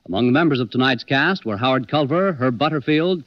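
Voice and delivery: ordinary talk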